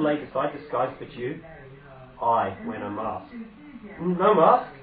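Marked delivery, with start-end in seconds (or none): 4.0-4.7 loud, higher, incredulous echo; startled disbelief. Acted. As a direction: throw the words back in shock. Queen reacts in disbelief.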